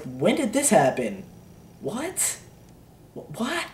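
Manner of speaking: small, very high rising squeak; mock bewilderment, high and soft; baffled disbelief. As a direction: stammer softly upward as if totally lost. Acts out confusion at the timeline.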